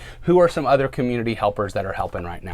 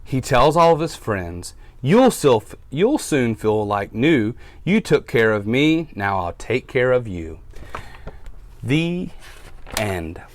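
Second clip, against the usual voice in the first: storybook read-aloud for little listeners; reading to children; slower than usual. A narrator's reading. Teacher reads to her class, storytime voice.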